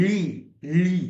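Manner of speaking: "লি" with the g left silent; Italian "gli" pronunciation. Shown as a demonstration. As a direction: model "লি" twice, separately, as li. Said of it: "এভাবে উচ্চারণ হয়".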